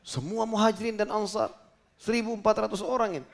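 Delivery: high-pitched, aghast protest; retold alarmed disbelief. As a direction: imitate someone stunned by the huge number. Jabir aghast at the crowd invited. (menirukan orang kaget, nada tinggi)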